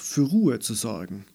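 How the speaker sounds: ordinary delivery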